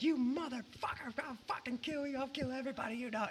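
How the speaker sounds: very high, strained mock screaming; mock losing it. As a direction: imitate a raging man without real volume. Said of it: "he is losing his mind".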